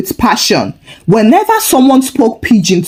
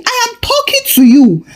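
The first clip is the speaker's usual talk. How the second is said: high-pitched, mildly angry narration; 13 semitones higher than usual. A narrator's reading. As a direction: voice jumps up in annoyance. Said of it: "He then added angrily".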